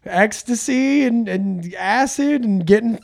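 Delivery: put-on old lady. Old lady boasting to comedian about her wild youth, mock voice. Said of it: "old ladies who just come up to me and, like".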